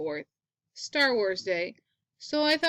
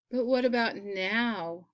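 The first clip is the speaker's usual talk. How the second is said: usual speaking voice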